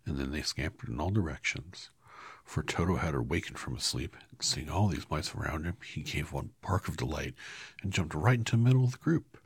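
usual speaking voice

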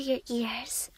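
breathy whisper right at the ear. Acted. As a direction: breathe the word into his ear.

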